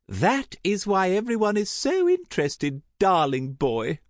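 high, swooping society lady; theatrical high-pitched gossip; effusive gossiping aunt. Acted. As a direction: gush with relish over every detail. Aunt Tessa relishes the scandal.

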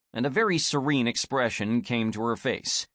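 usual speaking voice

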